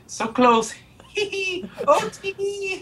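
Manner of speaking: high, tittering mock giggle; tee-hee like a sneaky kid who got away with it; hammed-up smug snicker; gleeful giggle. Gloating tweet read aloud.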